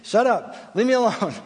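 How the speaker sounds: a hint of an annoyed four-year-old; imitating grumpy daughter. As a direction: snap it like a sleepy big sister. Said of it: "She's like".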